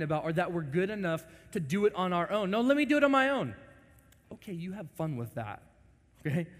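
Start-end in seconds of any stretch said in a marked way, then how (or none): 2.5-3.5 high, fast insistent cry; a child insisting on independence; rebellious child. Retold or imitated. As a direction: demand to do it alone.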